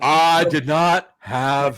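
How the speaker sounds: very slow, deliberate presidential denial; Bill Clinton. Acted. Podcaster mimicking a presidential denial, slow impression. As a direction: draw out each word like a politician denying scandal. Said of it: "had a Bill Clinton thing".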